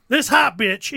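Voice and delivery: usual speaking voice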